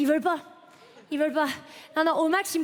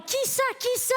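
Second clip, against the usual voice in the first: high mock shout over the music; shouting to be heard in a club; yelling over music; 8 semitones higher than usual. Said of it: "tout en hurlant".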